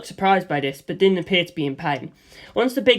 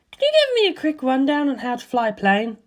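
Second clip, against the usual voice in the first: put-on higher breezy young woman's voice; Lisa Lisa; 7 semitones higher than usual. Lisa Lisa reassuring George about her skirt, higher girlish voice. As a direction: toss off reassurance lightly, in a higher girl's voice.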